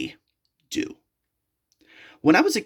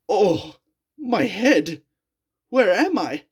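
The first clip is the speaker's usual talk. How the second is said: dazed; 4 semitones higher, slower than usual. A narrator's reading. Dazed waking after kidnapping.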